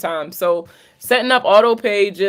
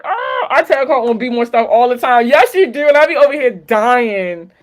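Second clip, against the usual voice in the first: big animated ribbing, pitched way up; animated teasing; 5 semitones higher, 6 dB louder than usual. Real, not acted. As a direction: get loud and high, hamming up the exasperation. Mock-complaint about friend's accent.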